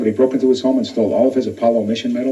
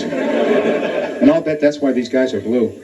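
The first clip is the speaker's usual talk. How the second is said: slow punchline; much slower than usual. Acted.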